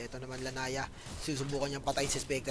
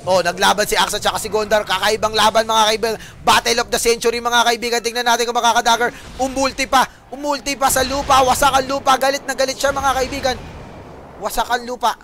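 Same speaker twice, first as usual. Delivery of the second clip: booming opening shout, then loud, raised commentary; excited play-by-play; 7 semitones higher, 14 dB louder than usual. Real, not acted. Caster announcing the battle of the century, excited shouting. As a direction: call the duel at full force.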